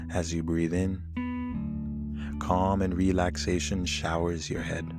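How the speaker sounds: calm and soothing over the music. Acted. Leads a guided meditation.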